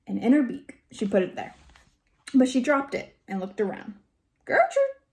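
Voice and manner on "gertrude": very high parrot screech; screaming bird. A narrator's reading. Bird that just dropped the wrapper, high squawky scream. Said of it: "she screamed again".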